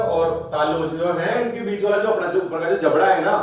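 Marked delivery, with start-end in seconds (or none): none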